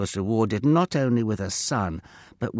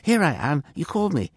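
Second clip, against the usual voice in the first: put-on high, louder young boy's voice; higher-pitched eager reply; eager boy answering; 8 semitones higher, 5 dB louder, faster than usual. A narrator's reading. Boy runs to answer a call.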